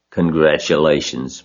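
usual speaking voice

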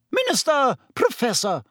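high-pitched cross matron; angry. A narrator's reading. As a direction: indignantly call them to order, high. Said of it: "said Madame Pomfrey angrily".

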